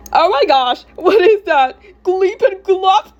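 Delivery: high, loud mocking squeal; mocking girl. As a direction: squeal in ridicule, ending on a drawn-out jeer. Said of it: "some random girl started ripping at me".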